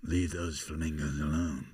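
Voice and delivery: low, soft, restrained order; film star warning. Acted. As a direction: warn the intruder quietly. Film star warns the fan.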